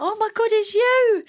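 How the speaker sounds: high, loud delighted squeal of recognition; bursting with joy at seeing her; thrilled recognition. Retold or imitated. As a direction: greet her like an old friend you never expected to see. Recognizing beloved midwife, thrilled.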